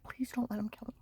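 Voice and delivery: frightened whispered begging; whispered plea. Retold or imitated. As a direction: breathe the plea without voice. Her retold plea to agent.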